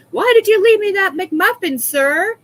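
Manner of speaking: high, loud hammed-up complaint; theatrical cry of protest; mock outraged shout. Mock complaint about the McMuffin delivery.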